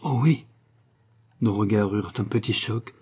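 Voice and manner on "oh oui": a quick, bright exclamation, a touch higher; eager cry. Acted. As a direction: jump at the offer with eager joy. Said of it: "elle s'écria".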